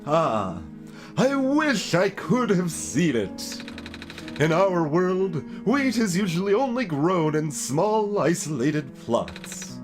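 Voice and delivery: animated plant expert, a little wistful; wistful plant keeper. Acted. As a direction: stretch the words with showy longing. Voices plant-keeper character in game.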